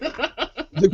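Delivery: ordinary delivery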